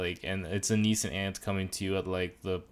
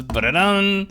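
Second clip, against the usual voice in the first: imitated jingle; 9 semitones higher, 8 dB louder, slower than usual. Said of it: "but it goes".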